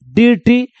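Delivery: "डीरटी" as DEER-tee; long ee where the ir is, in "डीरटी"; mistaken reading. Shown as a demonstration. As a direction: misread "डीरटी" letter by letter. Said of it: "क्या पढ़ सकता है?".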